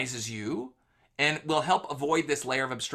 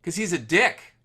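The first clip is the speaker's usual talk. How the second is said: defiant retort; 4 semitones higher, 6 dB louder than usual. Retold or imitated. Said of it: "And he's like".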